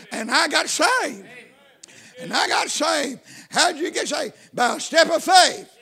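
preacher building to a testimony's high point; impassioned preaching. Real, not acted. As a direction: proclaim it emphatically, phrase by phrase.

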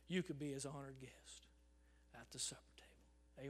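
fading soft whisper. Real, not acted. Preacher to the congregation, whispering the invitation. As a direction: let it fade to a whisper.

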